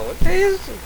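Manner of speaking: very high yelps and whoops; high excited squeals. Real, not acted. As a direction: squeal with delight and pain. Ragdoll flung around in game.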